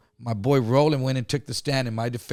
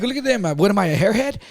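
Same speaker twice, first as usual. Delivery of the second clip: higher-pitched, incredulous retort; mock outrage at the very idea; indignant protest; 7 semitones higher, 4 dB louder than usual. Retelling his answer to accusers in court, indignant.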